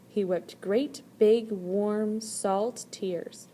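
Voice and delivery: ordinary delivery, nothing marked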